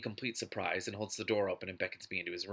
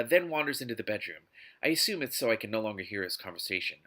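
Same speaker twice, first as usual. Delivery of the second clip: usual speaking voice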